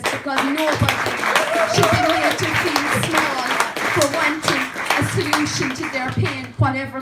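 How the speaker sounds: loud, climbing to a near shout; rally the room with it; passionate plea. Real, not acted. Rousing plea at public meeting.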